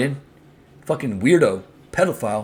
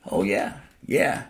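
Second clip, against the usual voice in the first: ordinary talk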